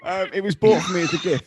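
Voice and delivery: laughing through the answer; giggling embarrassed reply. Real, not acted.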